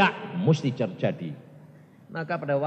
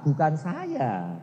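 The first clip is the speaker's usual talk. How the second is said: ordinary delivery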